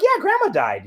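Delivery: high, cheerfully matter-of-fact; breezy confirmation. Retold or imitated. Repeating the news to stunned mom, breezy. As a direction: confirm it as no big deal.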